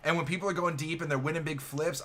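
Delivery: ordinary talk, nothing marked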